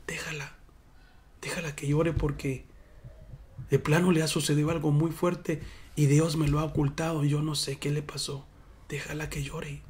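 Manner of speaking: the prophet gently quieting his servant; hushed prophet. Retold or imitated. Preacher voicing Elías hushing his servant, soft and low. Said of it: "el profeta le dijo".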